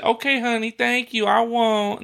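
put-on high, sugary old lady reply; mock sweet granny voice. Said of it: "You're supposed to say".

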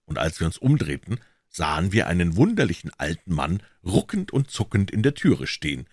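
ordinary delivery

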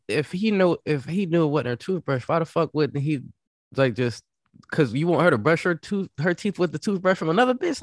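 high-pitched, baffled questioning; incredulous challenge. Real, not acted. Co-host questions the toothbrush story, incredulous and high.

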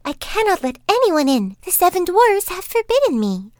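put-on high girlish voice, wary; young girl refusing. A narrator's reading. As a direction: refuse like an obedient child. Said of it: "put her head out of the window and said".

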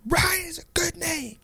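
imitating a yelling kid in a high, softened squeal; strained high mock-kid protest; Jaden. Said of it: "like, yelling".

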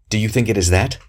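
a touch of hissed indignation. A narrator's reading. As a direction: throw the question out with mild fierceness. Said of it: "he hissed".

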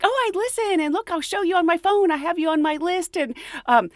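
high, eager gushing; excited fan. Retold or imitated. Fan showing Betty her phone, high and excited. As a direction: gush like a starstruck fan. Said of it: "she was like".

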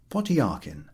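usual speaking voice

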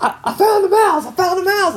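put-on high-pitched, breathless child's shout; excited kid. Daughter bursting out of the bathroom, shrieking with excitement. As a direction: squeal it like an excited little girl. Daughter repeating her discovery.

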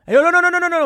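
very loud, high-pitched rapid yelling; alarmed mock yell. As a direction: yell frantically to stop someone. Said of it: "and he yells".

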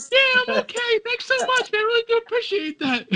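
very high, squeaky, stunned voice; dazed mock reply. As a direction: play himself punch-drunk and squeaking. Mocking his own dazed self after the hit.

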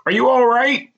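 high-pitched, uneasy question; squeamish male voice. A narrator's reading. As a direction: ask uneasily, pitched well up. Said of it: "a low, squeamish male voice".

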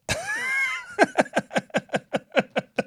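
high shrieking laugh. Real, not acted. Laughing about the broken leg, real shrieking laugh. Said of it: "bone is kinda sticking out".

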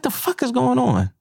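high-pitched what-the-hell; baffled. Retold or imitated. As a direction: shoot it up high, can't believe it. Card swipe fails, retold reaction.

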